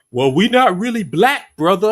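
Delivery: high, loud sneering mimicry; mock a self-denying brother; mocking quote.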